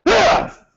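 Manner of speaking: sharp, high, sudden ah; explosive kiai. Shown as a demonstration. Instructor shows students an explosive kiai, sharp high shout. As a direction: snap the shout out from the first instant. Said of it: "Immediately, and it's explosive".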